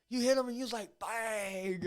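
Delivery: high, animated retelling with a punched sound; imitated excited reaction. Recalling Gav's reaction to a hit, imitated excitement. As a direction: play up a friend's ringside excitement.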